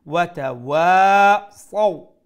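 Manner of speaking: ওয়া তাওয়া সাও loud, wa stretched, sau gliding; long wa, soft leen. Shown as a demonstration. Teacher reciting for students, drawn-out chant into short সাও. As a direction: chant a long ওয়া then glide softly through সাও. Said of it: "সুরটা নরম রাখবো".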